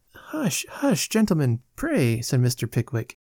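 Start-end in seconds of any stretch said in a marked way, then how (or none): 0.1-2.2 high, softened plea for silence; urgent hushing. A narrator's reading. Pickwick shushes the gentlemen as the ladies approach, urgent hushing. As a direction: shush them politely but urgently.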